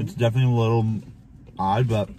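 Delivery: speaking between bites of crust; talking while chewing. Real, not acted. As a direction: mouth partly full. Seen 1: Left man bites the crust just before, then talks holding it.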